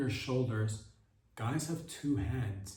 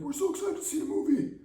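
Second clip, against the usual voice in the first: mock gushing fan, much higher and fast; breathless excited fan impression; high-pitched fan voice; 4 dB louder, faster than usual. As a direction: squeal it like an overeager fan. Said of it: "And they'll be like".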